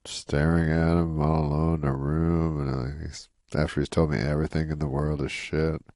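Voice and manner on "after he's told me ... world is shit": ordinary talk, nothing marked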